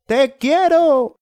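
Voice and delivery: very high, slow shouted declaration of love. Acted. Eduardo shouting his love up at Julia, high and slow. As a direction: belt it up high and slow to her. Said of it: "Gritó".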